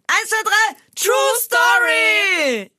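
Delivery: high drawn-out game-show shout; announcer-style call. Pretend. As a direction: sing out the show's title, stretching it long. Show title called out.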